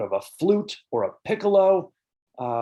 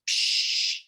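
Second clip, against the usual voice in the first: harsh psh noise. Shown as a demonstration. Teacher imitating a harsh bird call with a breathy psh. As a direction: hiss out a short noisy psh. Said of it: "Harsh sounds are those that sound really noisy".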